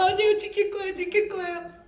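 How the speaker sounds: high mock child's plea; pleading child. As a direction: promise urgently like a little kid. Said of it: "그 애가".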